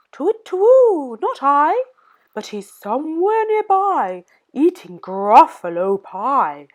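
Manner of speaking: put-on a sly owl teasing the child. Owl answers the Gruffalo's child.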